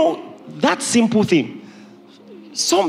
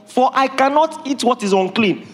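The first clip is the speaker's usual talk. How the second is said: protesting Peter; 4 semitones higher than usual. Retold or imitated. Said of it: "He said".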